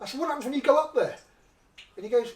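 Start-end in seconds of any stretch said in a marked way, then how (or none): 0.2-1.1 high incredulous question. Retold or imitated. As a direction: ask it in disbelief.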